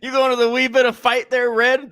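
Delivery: imitating a rowdy Irish fan; Irish accent teasing a redhead; mock Irish accent. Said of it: "It's easy".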